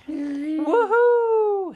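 high, loud joyful whoop; a big gleeful woohoo; excited cry. A narrator's reading. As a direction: shout it out with thrill. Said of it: "he cried as he raced down the sidewalk".

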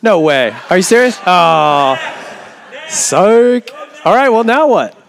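high, loud surprised disbelief; excited, can't believe it; dawning joy. Real, not acted. Winner found, then the number is off.

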